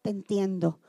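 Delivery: tierno, mucho más suave y bajo; consuelo sincero. Shown as a demonstration. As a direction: abrazar con la voz al que sufre. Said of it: "dígale". (ejemplo de pésame al doliente, tierno y muy suave)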